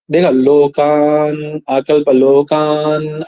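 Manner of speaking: लोकान आकल्प drawn out very slowly, syllable by syllable; rough recitation. Shown as a demonstration. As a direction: read लोकान, आकल्प slowly and roughly, stretching each sound. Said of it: "रफली उच्चारण हो रहा है ना?". ("लोकान, आकल्प" को बहुत धीरे, खींचकर, रूखे स्वर में बोलिए)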